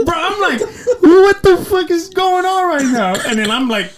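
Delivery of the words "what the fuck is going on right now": loud, slow, high-pitched mock wail; stunned disbelief. Man retelling how in-laws' banter floored him, high drawn-out disbelief. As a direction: stretch it into an incredulous squeal.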